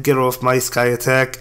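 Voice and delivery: ordinary talk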